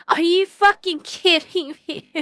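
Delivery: high, loud disbelief; incredulous outburst. Real, not acted. Streamer reacting in disbelief to bad news, incredulous outburst. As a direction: blurt it out high and loud, appalled.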